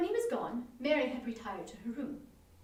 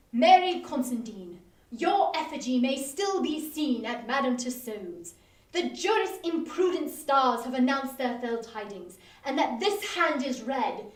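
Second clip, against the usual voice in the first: mock prophecy; 8 dB louder than usual. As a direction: declaim the fatal fortune like a stage oracle. Mock doom pronouncement.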